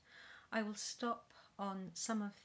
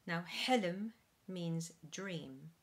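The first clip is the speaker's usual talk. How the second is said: ordinary delivery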